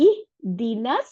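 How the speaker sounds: very slow, drawn-out tajweed chant; Quran recitation. Shown as a demonstration. Teacher recites the word for students, slowly chanted. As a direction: lean into each sound the way a reciter would. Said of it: "তাহলে".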